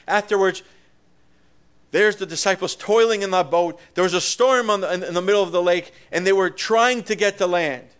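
energetic narration from the pulpit; lively preaching. Acted. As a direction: paint the storm for the congregation. Storm story, preaching.

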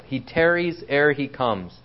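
ordinary delivery

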